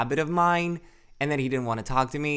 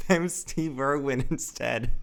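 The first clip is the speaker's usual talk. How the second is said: put-on Australian accent. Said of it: "I wish I had a cool accent".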